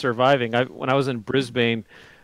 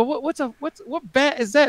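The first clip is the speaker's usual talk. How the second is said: high, stammering retold bafflement; baffled question; 11 semitones higher than usual. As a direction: stumble through it in pitched-up disbelief. Retells own baffled question.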